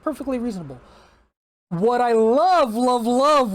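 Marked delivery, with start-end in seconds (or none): 1.7-3.5 drawn-out, louder emphasis on love; gushing. Real, not acted. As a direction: gush slowly over each love. Gushing praise of the change.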